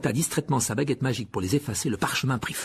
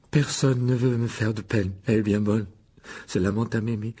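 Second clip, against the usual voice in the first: bitter mocking echo. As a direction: throw the words back, sulking and scornful. Wounded ghost throws the words back. (écho amer et sarcastique, traînant)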